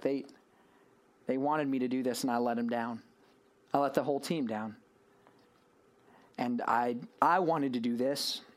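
low, subdued confession of failure; dejected Peter. Acted. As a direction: say it as a man who let everyone down. Imagined Peter, ashamed as he sinks.

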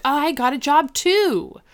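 high, eager mock excitement; excited echo. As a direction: chime in thrilled, voice lifted. Answers excited job news.

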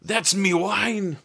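high, drawn-out Scottish cry; attempted Scottish accent. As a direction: imitate an indignant Scot, badly and without real volume. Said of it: "I can't do the accent".

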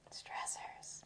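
whisper. Pretend. Joking whisper.

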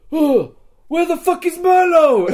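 gasp, then high, loud demand; re-enacted outraged shout. Rescued player demanding the missing friend, high outraged mock shout. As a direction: yell it in hammed-up outrage.

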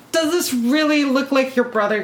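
stunned mother asking her daughter; incredulous question. Retold or imitated. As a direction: ask as if you can't trust your own eyes. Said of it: "I go".

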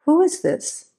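lightly voiced wife, pitched high and wary; wife answering a silent caller, high and wary; Kathy Ann on the phone. A narrator's reading. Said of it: "Kathy Ann's voice said".

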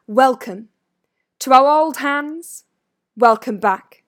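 loud, ringing welcome; Dumbledore's ringing voice. A narrator's reading. Headmaster greets returning pupils, loud and ringing. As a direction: project a grand greeting to the hall. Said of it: "in a ringing voice".

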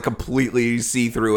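ordinary talk, nothing marked

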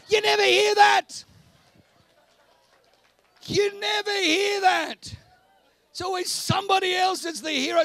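fiery preacher at full volume; preaching shout. Real, not acted. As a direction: shout it out to the crowd. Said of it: "preacher shouting".

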